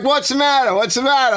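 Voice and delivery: high-pitched mock yell; retold protest. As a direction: call back in exaggerated bewilderment. Retold standoff with the cops.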